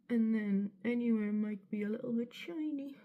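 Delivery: a hint of Bristolian, spoken softly; slight Bristolian accent. Real, not acted. Vlogger's accent slipping while doing makeup, slight Bristolian. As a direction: drift lightly into a Bristol accent. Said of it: "there I went a bit Bristolian".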